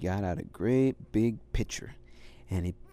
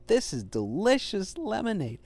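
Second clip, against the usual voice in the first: delighted little dog; 8 semitones higher than usual. Acted. Little dog tastes lemonade.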